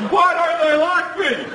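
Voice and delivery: high slow hammed-up sob of a question; mock weepy teacher. Relief teacher crying in her car, mock sobbing. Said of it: "she'd be crying over the steering wheel, just".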